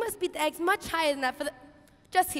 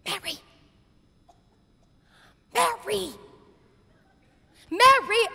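building call; 3 semitones higher, 5 dB louder than usual. Acted. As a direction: call louder each time. Calling Mary, building.

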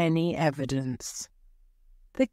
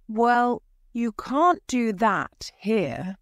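mildly cross, higher-pitched objection; slow, raised-pitch grumble of complaint; 6 semitones higher, slower than usual. A narrator's reading. Dormouse objecting to Alice growing in court, mildly cross. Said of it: "said the Dormouse crossly".

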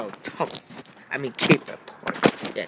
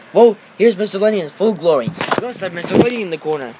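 ordinary delivery

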